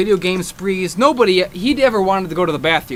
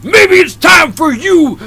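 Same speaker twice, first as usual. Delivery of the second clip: high, roaring, drawn-out rant; hammed-up furious tirade at full volume; enraged wrestling promo; 10 semitones higher, 4 dB louder than usual. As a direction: scream it like a wrestler cutting a promo. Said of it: "I was mad. I was".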